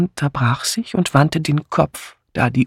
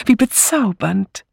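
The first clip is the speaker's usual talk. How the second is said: high, slow fluting gush; 8 semitones higher than usual. A narrator's reading. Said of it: "flötete Misses Easterbrook".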